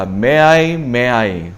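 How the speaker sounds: "may I" pressed, then falling away; emphatic repetition. Shown as a demonstration. Preacher showing the repeated Hebrew word, emphatic "may I". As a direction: hammer "may I", let the repeat drop. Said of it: "강조하는 거예요".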